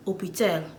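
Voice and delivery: "Hôpital" opening on the vowel; French silent H. Shown as a demonstration. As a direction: say "Hôpital" without any H. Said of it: "They don't like pronouncing H".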